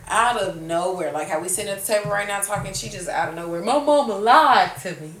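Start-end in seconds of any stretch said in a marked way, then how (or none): 3.6-5.1 loud, hammed-up boo-hoo wail; mock crying. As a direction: imitate a tearful drunk complaint.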